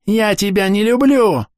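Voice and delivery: put-on high, slow screaming little girl; yelling child. A narrator's reading. Girl screams at Akimi while hitting her, high and loud. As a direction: yell it at her furiously. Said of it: "вопит Амелия".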